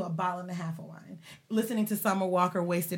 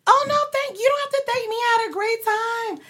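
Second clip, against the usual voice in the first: much higher, sugary mock woman's voice; mocking girly voice; 12 semitones higher, 6 dB louder than usual. Mocking the woman overheard on the butt dial, high sugary voice. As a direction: squeak it like a simpering girl. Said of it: "I hear something about".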